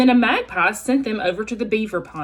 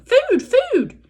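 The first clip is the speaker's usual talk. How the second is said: very high, louder chick squeak; hungry gosling begging the old goose; peeping gosling; 4 dB louder than usual. Acted. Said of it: "peeped the gosling".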